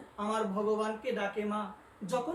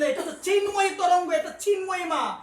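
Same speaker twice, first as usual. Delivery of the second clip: fervent shout; 7 semitones higher, 6 dB louder than usual. Real, not acted. Preacher exclaims holy dust's nature.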